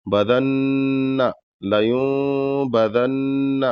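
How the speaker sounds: لیوم بدن with nun blended toward meem; nasal ghunna. Shown as a demonstration. Teacher demonstrating ikhfa nasal sound, slow. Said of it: "یہاں پر بھی غنہ کریں گے".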